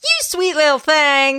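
lightly voiced old woman ghost; a hint of an old frontier woman; old Western woman ghost. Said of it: "a Western old woman".

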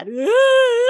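loud, high imitated crying wail; mock wail. Mother retelling the tearful drive home, mock wail. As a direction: let out a hammed-up sob.

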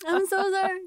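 high, wailing fake sorry; mock sobbing apology. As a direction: cry out a hammy apology. Mock apology after laughter.

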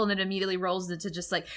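ordinary talk

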